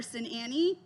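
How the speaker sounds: ordinary delivery, nothing marked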